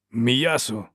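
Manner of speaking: mild narrated bark; one abrupt, demanding word. A narrator's reading. Dow demands food at the fire, barking. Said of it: "гаркнув Доу".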